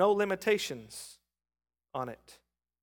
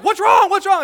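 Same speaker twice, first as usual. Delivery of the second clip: higher, louder alarmed question; worried wife; 10 semitones higher, 11 dB louder than usual. Retold or imitated. Wife Heather alarmed in the car, mock panic. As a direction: ask in a panicked hurry. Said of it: "Heather'd be like".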